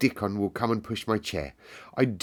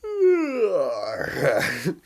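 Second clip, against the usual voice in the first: laughing; 9 semitones higher, 5 dB louder than usual. Real, not acted. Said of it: "nearly sent myself to sleep there".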